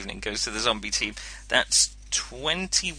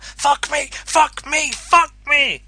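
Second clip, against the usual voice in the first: high, loud pained shouts; pained yelling; 13 semitones higher, 8 dB louder, much slower than usual. Acted. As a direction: yell it as the bite sinks in. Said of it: "he says".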